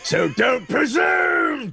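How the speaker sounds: high, slow, drawn-out cry; indignant character shout. Acted. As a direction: shout it up high, stretching each word. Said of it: "I'll say".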